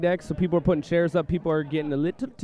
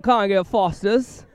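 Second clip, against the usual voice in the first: a bad Australian accent that slides toward British, slow; failed Aussie accent; 4 semitones higher, slower than usual. Co-host tries an Aussie accent and sounds British. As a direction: try an Aussie twang and miss. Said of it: "I am a Brit all of a sudden when I tried to do an Aussie".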